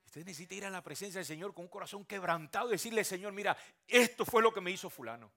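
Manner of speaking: loud, high, impassioned sermon; fiery preaching. Acted. Pastor urging the congregation to pour out their hearts, shouting. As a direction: preach it out to the back row.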